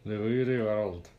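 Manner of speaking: words without moving lips; talking with mouth closed. Shown as a demonstration. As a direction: say "The weary world" with lips still. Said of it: "Say it without moving your lips".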